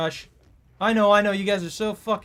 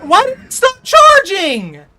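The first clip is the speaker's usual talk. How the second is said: high, loud and slow; real gamer outrage; outraged yell; 10 semitones higher, 12 dB louder than usual. Real, not acted. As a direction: stretch it out in disbelief. Ability failed mid-chase.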